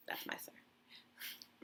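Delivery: breathy whisper, very slow and soft; a slow private whisper. Real, not acted. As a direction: whisper it to yourself, drawn out. Whispered aside.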